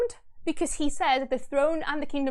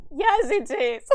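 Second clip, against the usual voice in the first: loud, high interjection; fervent agreement; 5 semitones higher, 6 dB louder, slower than usual. Real, not acted. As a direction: burst in to agree wholeheartedly.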